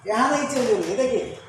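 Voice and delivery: ordinary delivery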